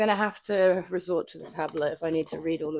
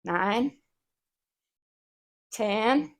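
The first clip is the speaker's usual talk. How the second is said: careful attempt at American counting; put-on American vowels; American accent; 3 semitones higher, 4 dB louder than usual. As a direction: try out a pretend American accent. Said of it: "hard to do an American accent for counting".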